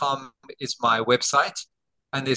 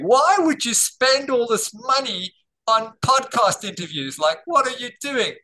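high, slow, mock scolding; mock angry wife; 9 semitones higher, slower than usual. Wife scolding husband over podcast spending, high mock irritation. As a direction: nag like an irritated wife, pitch up. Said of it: "She keeps going".

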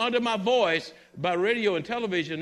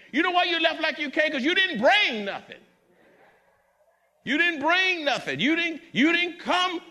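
higher, louder and fast rebuke; preaching shout; 9 semitones higher, 4 dB louder than usual. Real, not acted. Preacher rebukes complaining churchgoers with a loud shout. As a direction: hurl it back at the complainer.

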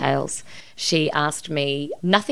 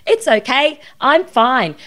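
higher, louder chirpy put-on brightness; mock cheerful reassurance, pushing through; brave polite front; 8 semitones higher, 7 dB louder, slower than usual. Imitating how she'd reassure her mum, a higher, louder mock-brave chirp. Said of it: "be polite about it and be brave and go".

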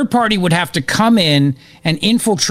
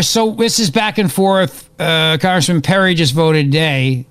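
usual speaking voice

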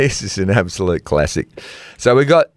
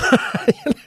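a quick high laugh breaking the mock announcement; 13 semitones higher than usual. Real, not acted. Host laughing at his own loudspeaker joke. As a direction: crack up mid-line.

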